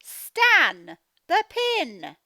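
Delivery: words dragged out loudly; slow loud quote. Acted. Teacher reading mum's line slowly and loudly. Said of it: "The mum is saying".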